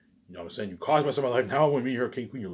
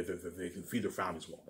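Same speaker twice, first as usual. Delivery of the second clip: ordinary delivery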